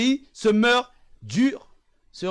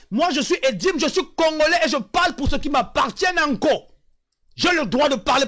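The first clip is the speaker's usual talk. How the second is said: defiant shout; 3 semitones higher than usual. Real, not acted. Congolese man defends his right to speak, shouting. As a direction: shout your identity with pride. Proud claim, shout heard.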